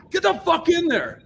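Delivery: very high, loud retold order; mock angry yell; Fletcher. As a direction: imitate an exasperated boss yelling a command.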